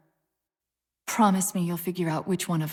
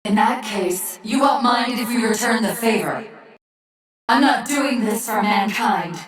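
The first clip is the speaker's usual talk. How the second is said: louder, menacing and cold; furious threat; 8 dB louder than usual. Acted. Rampant AI threatening the Chief, furious and loud. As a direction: spit the threat out, slow on the last line.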